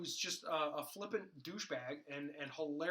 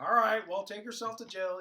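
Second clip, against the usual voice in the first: resigned Flash; 6 semitones higher, 12 dB louder than usual. Retold or imitated. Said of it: "He goes".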